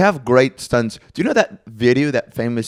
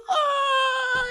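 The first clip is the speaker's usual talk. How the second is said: very high, strained wail, no words; a squeaked cry of outrage; 5 dB quieter than usual. Retold or imitated. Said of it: "He goes".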